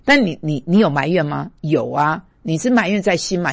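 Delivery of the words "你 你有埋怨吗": normal talking voice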